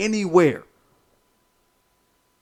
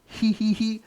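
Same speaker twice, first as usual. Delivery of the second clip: mock sly chuckle; 5 semitones higher, 4 dB quieter than usual.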